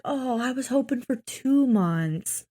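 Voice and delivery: lightly voiced whiny patient; whiny Gary. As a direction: whine like a lazy kid. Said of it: "Gary's just like".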